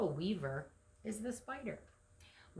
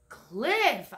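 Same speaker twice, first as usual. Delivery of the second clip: sharp high yelp, louder; 5 semitones higher, 11 dB louder than usual. Acted. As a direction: yelp the word in alarm. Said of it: "Olive yelped".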